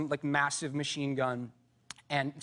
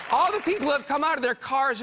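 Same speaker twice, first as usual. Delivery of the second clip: normal talking voice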